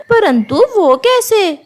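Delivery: high shocked squawk of disbelief; shocked crow. Acted. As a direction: gasp it out, caught off guard. Crow landlady shocked by news. (चौंकी हुई कौवी की ऊँची आवाज़)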